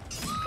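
sudden squeal of dismay; a high cry when caught; high shriek. Real, not acted. Downed by killer.

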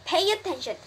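high, urgent command; mild scream. A narrator's reading. Wilbur rouses the sleepy rat, high mild yell. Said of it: "screamed Wilbur".

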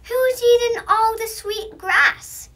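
high, loud indignant complaint; grumbling pig. A narrator's reading. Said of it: "he grumbled".

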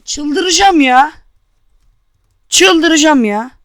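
exasperated. Real, not acted. Frustrated after dying.

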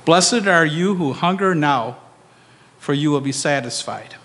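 normal talking voice

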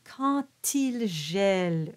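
slow "quand il", the D sounded as T; D becomes T. Shown as a demonstration. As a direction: link "quand il" with a clear T. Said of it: "and it becomes a T".

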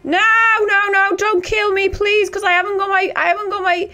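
high panicked yelling; being chased. Real, not acted. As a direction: shriek in real panic while fleeing. Attacked by monster, begging.

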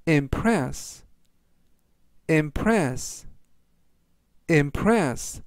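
impress read out slowly and deliberately; slow word reading. Shown as a demonstration. Teacher reading words for learners to follow, slowly. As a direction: say impress slowly, leaving room to repeat. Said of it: "제가 천천히 세 번씩 읽어드리고요".

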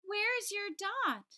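high, slow, wondering ask; puzzled question. Acted. Playground friends asking little I, high and slow. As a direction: draw the question out in surprise. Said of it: "they said".

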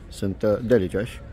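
usual speaking voice

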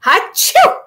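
loud high sneeze; big sneeze. A narrator's reading. Old rabbit sneezes as rain hits his eye. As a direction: burst out in one big sneeze. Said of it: "made Uncle Wiggily sneeze so hard".

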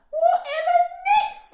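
very high, loud laughing hoot; hooting with laughter. A narrator's reading. Parents disbelieve Daisy, hooting with laughter. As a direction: whoop it through mocking laughter. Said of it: "Mum and Dad roared with laughter".

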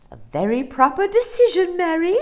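high, loud hearty approval; Amelia the approving dolphin. Acted. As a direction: praise with toothy relish.

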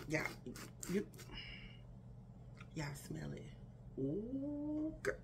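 normal talking voice

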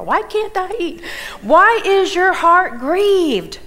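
high, loud baffled husband voice; puzzled husband. Retold or imitated. Husband questioning his weeping wife, high and bewildered. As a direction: ask it like a clueless, bewildered man. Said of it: "notice what he says to her".

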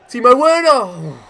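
high, loud, stretched cry of the name; over-excited yell. Real, not acted. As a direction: lose it as the striker breaks through. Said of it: "Getting far too excited here".

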